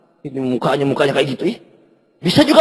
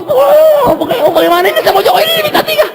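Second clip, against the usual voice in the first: much higher, pushy pleading; pleading whine. Retold or imitated. Son hurrying his mother for money, high pleading whine. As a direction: nag your mother like an impatient kid.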